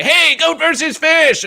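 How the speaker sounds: high, slow cheery hail; fish calling out. Retold or imitated. Fish greets Goat versus Fish at the convention, cheery call. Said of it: "a fish from a prior verses will say".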